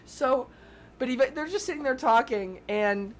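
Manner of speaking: usual speaking voice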